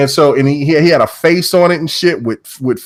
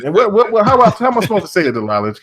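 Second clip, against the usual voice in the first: ordinary talk, nothing marked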